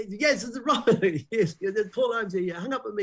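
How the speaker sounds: irate caller, high and loud; mock-indignant phone voice; Paul Holmes. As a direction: imitate an indignant caller pitched right up. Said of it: "So the phone rings".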